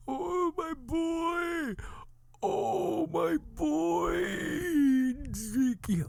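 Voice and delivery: high, slow, wailing sobs; keen over a lost son, hammed up; exaggerated drawn-out grief wail; mock crying. Reprise of acted crying.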